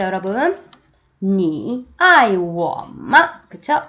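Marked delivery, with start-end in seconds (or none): 1.2-3.4 very slow, syllable by syllable; slow teacher demo; slow model reading. Shown as a demonstration. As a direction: spell out 你很漂亮 slowly. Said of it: "의문문을 쓸 때는".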